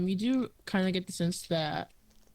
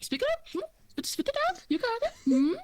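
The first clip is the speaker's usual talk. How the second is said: put-on taunting old lady. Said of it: "she's like".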